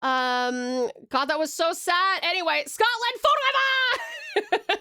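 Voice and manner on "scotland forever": high, drawn-out Scottish shout; slow, high mock war cry; mock battle cry. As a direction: cry out a rallying call, hammed-up. Joke battle cry after Scottish story.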